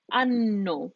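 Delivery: ANNO with the N held long, "an-no"; double N. Shown as a demonstration. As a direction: hit both Ns in ANNO. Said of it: "hit those two N's twice".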